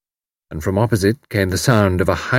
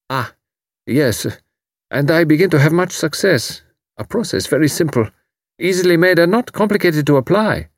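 higher, lifting with pride; brightening young German; 8 semitones higher than usual. A narrator's reading. Refugee telling Tuppence of success, brightening. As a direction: warm up about his work. Karl brightening about his work.

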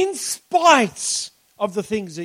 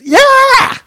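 high, loud cheering yeah; loud joyful cheer of a worshipper; 12 semitones higher, 12 dB louder than usual. Retold or imitated. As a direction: burst out with a happy shout. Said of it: "stick it out 'cause it's".

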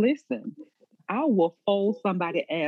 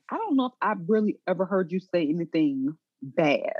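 ordinary talk, nothing marked

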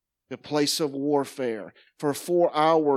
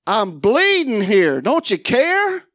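high, slow, pleading cry; anguished plea; 7 semitones higher, 6 dB louder, slower than usual. Retold or imitated. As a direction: cry out like someone who is hurting. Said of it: "And I'm like".

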